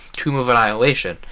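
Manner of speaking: ordinary talk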